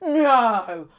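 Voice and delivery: imitating a Cockney woman in disbelief; lightly put-on Cockney protest. Cockney woman reacts to friend's age, Cockney. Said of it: "She's very Cockney".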